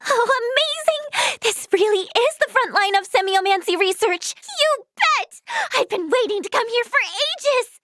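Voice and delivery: high-pitched, eager wonder; thrilled. Acted. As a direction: let the excitement lift your voice. Thrilled to visit research consortium.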